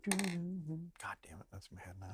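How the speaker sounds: breathy whispered complaint. Real, not acted. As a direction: whisper it under your breath. Song stuck in head.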